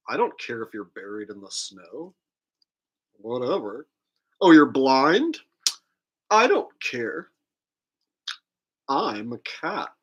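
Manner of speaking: a lightly voiced bored cat; sassy cat voice. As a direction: give the cat a careless sneer. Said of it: "Whereas a cat's like".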